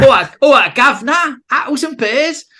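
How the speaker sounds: loud, high movie-Cockney shout; London accent. Shown as a demonstration. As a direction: holler like a film Cockney. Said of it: "obviously London goes from, as you've, everyone's seen films".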